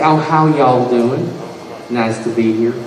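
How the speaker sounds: put-on easygoing Southern accent, unhurried; Southern gentleman. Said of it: "he's a Southern gentleman".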